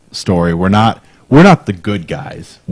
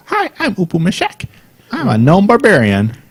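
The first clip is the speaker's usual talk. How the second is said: very high-pitched silly gnome voice; gnome barbarian; 11 semitones higher than usual. Acted. Player demonstrating their character Upu Mashek, high silly gnome voice. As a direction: squeak it out to annoy the party. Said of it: "He talks like this".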